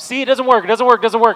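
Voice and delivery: loud, high, exasperated mock whining; frustrated complaint. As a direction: throw up your hands, it doesn't work. Acting a frustrated faster.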